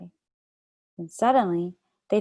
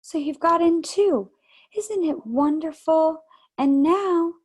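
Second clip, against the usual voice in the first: higher, louder, drawn-out delighted cry; crying out with joy; 9 semitones higher, 6 dB louder than usual. A narrator's reading. Lucy spotting her brother in the wood, delighted cry. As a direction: call his name in sheer delight. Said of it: "too happy and excited".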